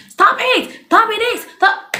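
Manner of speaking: put-on high sharp yell at a child; mock parent yelling. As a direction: shout it like a cross parent at the top of your voice. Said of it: "shouting on top of your voice".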